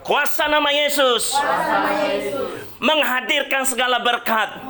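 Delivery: prayer at full force, half joking; mock prayer. Pastor playfully prays over the couple's shop, prayer voice. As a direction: hammer out a blessing like a pastor praying.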